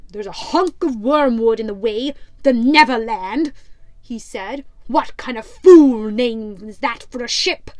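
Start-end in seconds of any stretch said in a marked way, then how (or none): 0.1-3.5 louder, gruff pirate captain scoffing. Acted. Pirate captain mocks the rival ship, loud and scornful. 4.9-7.7 louder, gruff pirate captain scoffing. Acted. Pirate captain mocks the rival ship, loud and scornful.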